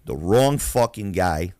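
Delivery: usual speaking voice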